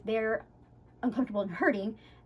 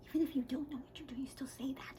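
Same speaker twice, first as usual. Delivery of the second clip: quick confidential whisper; whispered aside; 13 dB quieter than usual. Shown as a demonstration. Groomer letting viewers in on a trick, whispered aside. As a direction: whisper it like a secret tip.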